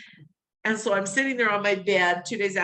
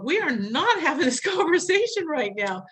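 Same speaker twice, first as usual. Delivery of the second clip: high, laughing brush-off; brushing off her dying husband's talk, laughing; laughing protest; 7 semitones higher than usual. Retold or imitated. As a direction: laugh it off in disbelief. Refusing remarriage talk at deathbed.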